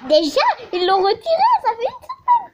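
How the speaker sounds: half-outraged, half-laughing; laughing exasperation. Real, not acted. Player complains to viewers, laughing in disbelief. Indignant about removed item.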